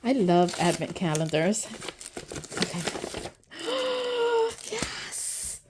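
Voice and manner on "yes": high gasp into a whispered yes; gasp, then breathe out a soft, excited yes; delighted gasp. Real, not acted. Delighted at opening first item.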